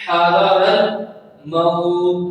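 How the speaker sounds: slow chanted حذر الموت with a long "maw" and closed "t"; stopping form. Shown as a demonstration. Teacher shows the preferred pause, slow chant stopped on "mawt". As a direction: stretch الموت, then stop on a vowelless ت. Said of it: "یہاں پہ وقف کر لیں".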